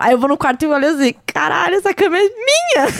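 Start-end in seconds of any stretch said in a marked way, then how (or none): 1.2-2.9 higher, rising pitch of marveling disbelief; amazed exclamation. Retold or imitated. Adult alone at home marveling that the bed is hers, amazed. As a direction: marvel aloud, climbing high on the last words. Reencena espanto com a própria casa.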